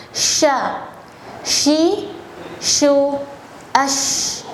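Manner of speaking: sha shi shu ash, each sh airy and spread; over enunciating. Shown as a demonstration. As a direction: ash ends in a long hissed sh. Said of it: "angin huruf shin".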